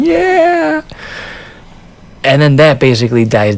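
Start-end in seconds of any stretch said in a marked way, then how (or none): 0.0-0.8 very high whoop of women; women cheering. Retold or imitated. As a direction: squeal it like the women. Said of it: "And even women".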